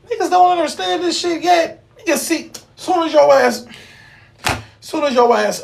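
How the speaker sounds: fired up. Real, not acted. Preaching to viewers, worked up.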